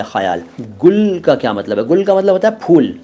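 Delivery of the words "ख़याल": ख्याल as 'kha-yaal', throaty fricative kh; correct pronunciation with nukta. Shown as a demonstration. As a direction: say ख्याल lower, with full first vowel and scraped kh. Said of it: "पूरा ख है, उसके नीचे नुक्ता है". (नुक़्ते वाला ख़, पूरा बोला हुआ ख्याल)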